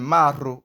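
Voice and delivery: "Maro" with a trilled r, punched; rolled R. Shown as a demonstration. Teacher giving the name, rolled r in Maro. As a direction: roll the r in "Maro". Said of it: "by rolling your tongue".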